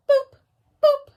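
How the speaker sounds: short, high, loud boops; high boop sound. Shown as a demonstration. Teacher showing the listening sound, high boops. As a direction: pop out two bright boops. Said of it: "It goes just like this".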